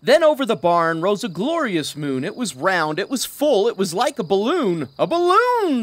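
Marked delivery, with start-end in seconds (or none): 5.0-5.8 high, loud discovery; excited shout. Acted. The cow spotting the moon-balloon, high and loud. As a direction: shout out the idea. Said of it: "the cow shouted".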